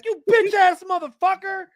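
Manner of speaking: very high, squealed insult; hammed-up furious driver; mock road rage. Driver cursing the honking woman, mock-furious.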